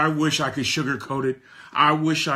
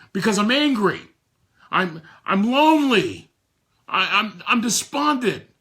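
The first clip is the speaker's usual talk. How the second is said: higher and louder, stammering anger; angry answer; 7 semitones higher, 4 dB louder than usual. Retold or imitated. Grieving Rick answering the coach, angry and despondent. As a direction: blurt out the raw feelings. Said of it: "Why are you stuck".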